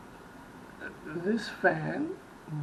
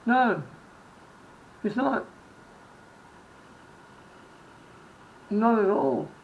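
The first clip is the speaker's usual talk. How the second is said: emphatic denial; 5 semitones higher, 6 dB louder than usual. Real, not acted. Defending Gene against comments.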